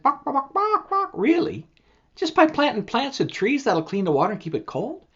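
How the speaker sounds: high clucking chick, amazed. Acted. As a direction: cluck then ask in wonder. Chick answers the creek.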